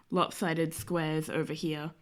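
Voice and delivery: ordinary talk, nothing marked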